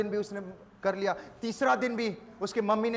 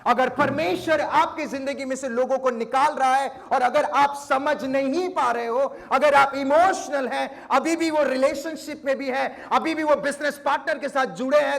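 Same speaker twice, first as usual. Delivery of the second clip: louder, punchy sermon build; fervent preaching; 4 semitones higher, 5 dB louder than usual. Acted. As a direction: warn the congregation with rising force. Preaching warning to congregation.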